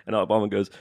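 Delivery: normal talking voice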